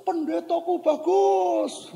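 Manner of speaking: high, slow holler; drawn-out, high-pitched call; child calling out. Retold or imitated. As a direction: imitate a kid calling praise across a ravine. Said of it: "Anak itu".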